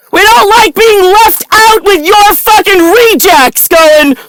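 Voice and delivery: a furious rant about being left out of the clubhouse; angry shouting. Real, not acted. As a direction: shout it like you've been shut out.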